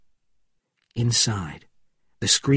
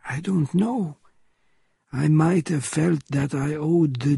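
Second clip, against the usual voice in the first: measured, slightly raised character voice; old Frenchman, grave; 4 semitones higher than usual. A narrator's reading. Old Frenchman admitting to Marie he might have killed, gravely. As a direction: weigh the confession with pauses between phrases.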